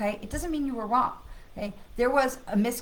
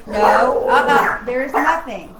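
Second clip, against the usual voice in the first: scolding the dog; 3 semitones higher, 13 dB louder, slower than usual. Real, not acted. Owner tells barking dog there is nothing, loud scolding. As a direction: call the dog off loudly.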